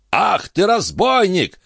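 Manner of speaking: put-on high-pitched angry old baron; furious high-voiced outburst; enraged roar. A narrator's reading. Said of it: "взревел он".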